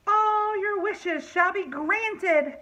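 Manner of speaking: high, slow fairy proclamation; grand fairy godmother voice; fairy crying out spell. Acted. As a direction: cry it out like a magic fairy. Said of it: "cried the fairy".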